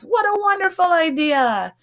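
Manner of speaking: high, delighted squeal; excited cheer. Acted. Excited mice cheering the bell idea, squealing with joy. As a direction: cheer like you just won.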